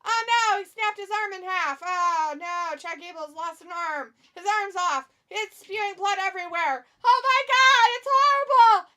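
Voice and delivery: loud, high hammed-up panic; mock horror. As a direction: shriek the play-by-play as if witnessing gore. Mock horrified commentary on wrestling match.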